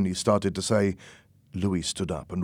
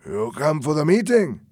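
bad English, thick foreign accent, pitched up and loud; a gangster with a thick foreign accent; strong foreign accent; 7 semitones higher, 6 dB louder than usual. A narrator's reading. Stranger in a restaurant greets Tony in a thick foreign accent. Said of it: "in bad English, with a very strong accent".

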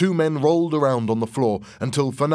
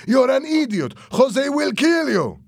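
very high, loud warning; angry shout; 7 dB louder than usual. A narrator's reading. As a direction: yell the insult and threat. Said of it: "Fernando shouted".

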